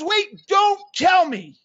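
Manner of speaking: high, loud, slow halting command; retold insistent interruption; emphatic cut-in. Grandfather stopping his grandson's answer, emphatic.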